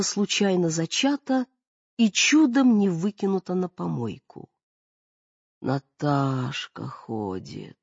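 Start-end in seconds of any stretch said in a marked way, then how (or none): 5.6-7.8 slow, heavy, moaning drawl; drawn-out moan. A narrator's reading. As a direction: stretch it out like a groan. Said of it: "тяжело протянула, как простонала".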